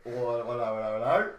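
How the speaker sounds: nosy Cockney officer walking in on something; Cockney policeman. Acted. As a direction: play a suspicious Cockney bobby. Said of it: "Is it in a Cockney accent?".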